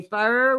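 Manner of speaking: "fur" drawled Southern; "fur" in a Memphis accent. Shown as a demonstration. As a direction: say "fur" like back home. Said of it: "we say".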